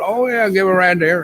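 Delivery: a hint of a folksy farmer; farmer guy. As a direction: offer the ride like a friendly country driver. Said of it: "farmer guy".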